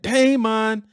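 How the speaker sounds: high, loud calling out across a store; calling a name. Retold or imitated. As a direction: call for the lost child. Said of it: "I can hear that".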